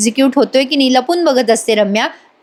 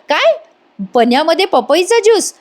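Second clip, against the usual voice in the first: higher-pitched shocked echo; acted disbelief; 6 semitones higher than usual. Aunt learning of juice in the drink, acted disbelief. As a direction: react as if just hearing shocking news.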